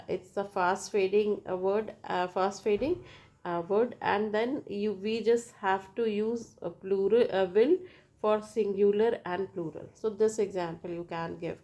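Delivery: ordinary talk, nothing marked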